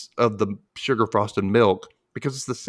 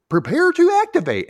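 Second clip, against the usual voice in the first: high, louder mad-scientist call; cartoon voice; 5 dB louder than usual. Doctor Stupid starting his helmet, high. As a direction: announce it like a goofy scientist. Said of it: "he says".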